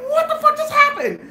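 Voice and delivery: very high, stunned squeal; retold aghast disbelief; frozen in shock. Friends frozen watching the beating, high-pitched shock. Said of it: "frozen, like".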